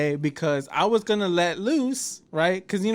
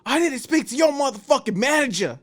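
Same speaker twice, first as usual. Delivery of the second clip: mock angry demand; 8 semitones higher than usual. Man behind him demanding the manager, mock angry. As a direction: play the guy who's fed up. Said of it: "the first thing this guy says is".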